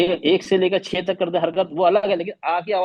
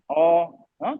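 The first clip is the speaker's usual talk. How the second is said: ordinary delivery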